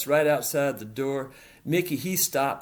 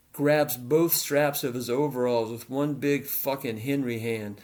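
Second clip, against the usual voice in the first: usual speaking voice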